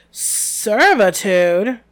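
loud, drawn-out incredulous scorn; scathing. A narrator's reading. Hagrid throwing a centaur's word back, scathing. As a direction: stretch the word scathingly, rising at the end. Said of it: "said Hagrid scathingly".

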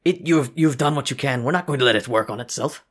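a guard reproaching the construct; stern guard voice. Acted.

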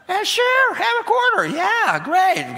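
put-on father giving his little girl a quarter, gushing; gushing dad. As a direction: pour out an overeager yes with a laugh. Dad hands over quarter.

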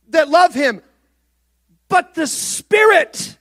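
high, loud and slow, almost shouting the revelation; fervent preaching. Acted. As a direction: preach it out full force. Preaching peaks again.

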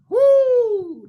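high, loud woo; excited preacher whoop. Real, not acted. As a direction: whoop with delight at the verse. Preacher exclaims at scripture.

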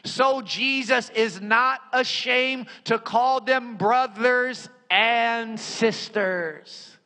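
ringing, emphatic pulpit delivery; full-force preaching. Real, not acted. Preacher reads scripture to the congregation, full-force preaching. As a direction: proclaim it with drawn-out emphasis.

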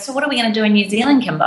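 ordinary delivery

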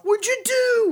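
high-pitched mock yell; squealed accusation at another driver; mock outrage. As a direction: cry out in exaggerated, put-on shock. Acting out staged outrage after crash.

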